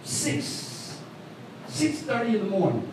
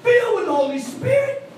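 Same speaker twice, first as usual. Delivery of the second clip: preaching high; 13 semitones higher, 3 dB louder than usual. Acted. Preaching climax.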